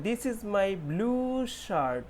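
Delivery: "blue" with a long stretched oo; very slow teaching read. Shown as a demonstration. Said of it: "ये जो ब्लू है". ("blue" में लंबा ऊ)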